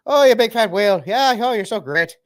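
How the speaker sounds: put-on high, loud caricature of an Irish accent; mock Irish accent. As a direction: deliver insults as sweet Irish praise. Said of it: "saying it with an Irish accent".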